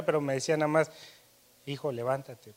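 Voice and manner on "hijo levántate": soft, half-hearted wake-up call; mother gently waking her child, not wanting to; reluctant waking call. Retold or imitated. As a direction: say it softly, as if hoping they sleep on. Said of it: "una voz como queriéndome no levantar".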